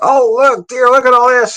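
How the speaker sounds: high-pitched eager marvelling; excited exclamation. Retold or imitated. Husband showing his wife the barrel room, excited. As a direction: call a partner over, thrilled.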